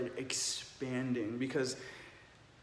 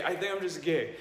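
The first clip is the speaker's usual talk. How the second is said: laughing through the words, a bit higher; tearful laugh of release; laughing relief; 4 semitones higher, 3 dB louder than usual. Retold or imitated. Retold confession with laugh.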